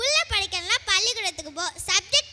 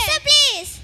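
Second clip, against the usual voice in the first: higher, louder plea; urgent pleading; 4 semitones higher, 5 dB louder than usual. Acted. Traveller keeps begging the man who refuses, urgent pleading. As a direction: beg over the refusal.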